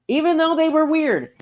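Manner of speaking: high, insistent "even though they were weird"; emphatic repeat. Real, not acted. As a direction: push the point up high. Repeats the point in contrasting takes.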